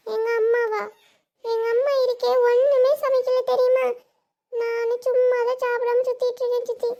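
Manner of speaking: put-on high, loud child's grievance; complaining little niece. Niece tells aunt mother cooked nothing, complaining child voice. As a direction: complain like a hungry little girl.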